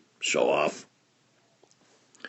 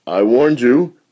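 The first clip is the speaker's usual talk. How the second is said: stern scolding; 5 semitones higher, 8 dB louder than usual. Acted. Scolding parent.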